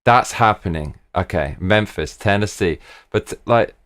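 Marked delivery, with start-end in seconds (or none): none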